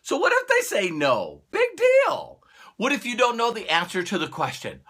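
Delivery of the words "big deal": high, sing-song Big deal; dismissive. Real, not acted. Waving off a prospect saying no, dismissively. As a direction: brush it off breezily.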